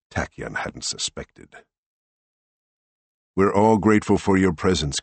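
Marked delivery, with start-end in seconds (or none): 3.4-5.0 louder, slightly raised senator voice; Greg's voice. A narrator's reading. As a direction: speak up like a diplomat. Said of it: "Greg said".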